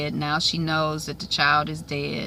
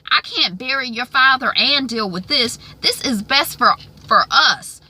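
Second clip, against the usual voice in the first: imitated mother pleading and scolding, pitched up; overbearing mother; 5 semitones higher than usual. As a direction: play a pushy mom insisting. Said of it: "Melissa's mother".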